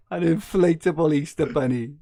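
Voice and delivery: high squeaky laughing read; laughing while reading. Real, not acted. Host reading a listener's message, laughing hard. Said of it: "Luke Shiletto says".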